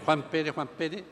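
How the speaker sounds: low, soft repeated muttering; under-the-breath searching; muttered list-checking. Acted. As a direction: murmur the name to yourself while searching a list. San Pedro searches his list.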